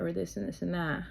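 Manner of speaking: ordinary talk, nothing marked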